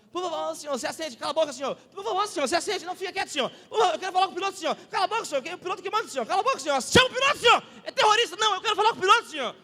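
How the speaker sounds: frantic shouted argument. Retold or imitated. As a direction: act out a crew member battling an insistent passenger. Acted quarrel with flight attendant.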